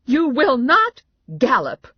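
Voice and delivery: loud, high, slow scolding; mother yelling. A narrator's reading. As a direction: lay down the law at a child. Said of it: "my mother yelled at Mary Alice".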